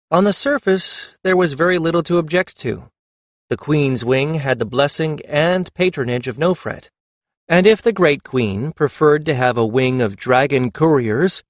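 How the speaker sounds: ordinary delivery, nothing marked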